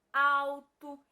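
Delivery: "alto" loud and drawn out as "au-to"; sounded-out word. Shown as a demonstration. Teacher gives a word example, loud drawn-out "alto". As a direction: stretch the opening "au" of "alto", loudly. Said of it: "alto com L e eu tenho alto com U".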